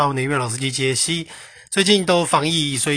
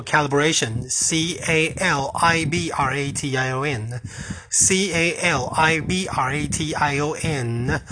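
spelling it out; much slower than usual. Shown as a demonstration. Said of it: "那它怎么拼？".

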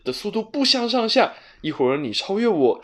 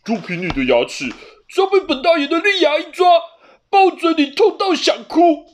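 much higher, louder, fast bossy cat; domineering cat; 13 semitones higher, 7 dB louder than usual. A narrator's reading. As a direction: lord it over the other cat. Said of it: "黑猫盛势凌人地说". (盛气凌人的黑猫，又高又响地抢白)

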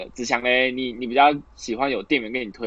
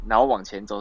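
usual speaking voice

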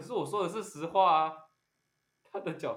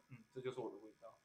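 hushed musing; 4 semitones lower, 15 dB quieter, faster than usual. Pretend. Acting her own smug musing.

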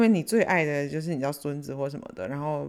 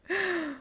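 a sudden high laugh mid-sentence; high burst of laughter. Real, not acted. Telling viewers a funny wedding story, breaks into a high laugh.

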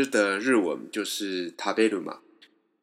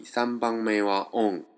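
usual speaking voice

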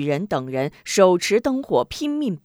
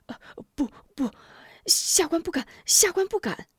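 high, halting, stammered pleading; terrified trembling; 10 semitones higher than usual. A narrator's reading. Frightened official denying it to his superior, trembling. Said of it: "张雄吓得魂不附体，战战兢兢".

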